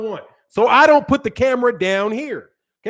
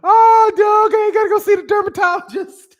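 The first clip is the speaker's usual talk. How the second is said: loud, high-pitched mock wail; mock outcry; 9 semitones higher, 3 dB louder than usual. Streamer protesting a jab about his skin, mock outcry.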